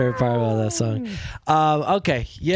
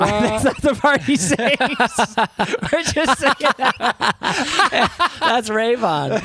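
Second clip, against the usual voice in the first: high laughing voice, slowed; laughing at their own sing-along; 9 semitones higher, 3 dB louder, slower than usual. Real, not acted. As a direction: talk through real laughter.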